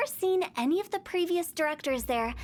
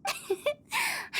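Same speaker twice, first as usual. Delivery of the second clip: very high, airy falling giggle; teasing high titter; playful giggle. Acted. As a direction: laugh off the complaint impishly. Hu Tao laughs off complaint.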